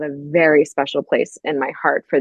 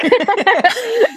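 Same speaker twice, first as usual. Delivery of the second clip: squealing high giggle; high laugh; 3 dB louder than usual. Real, not acted. Reacting to sister's jealousy.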